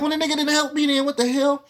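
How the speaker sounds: high, fast, disbelieving rant; exasperated high-pitched complaint; incredulous rant. Retold or imitated. Retold frustrated thought.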